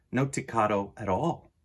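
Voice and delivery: plain reading voice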